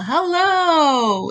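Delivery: long, high call that falls away; drawn-out mock yell, high and falling; yelled hello. Shown as a demonstration. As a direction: holler it out into a cave. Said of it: "you yell".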